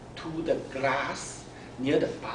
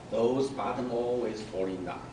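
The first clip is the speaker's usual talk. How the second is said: ordinary delivery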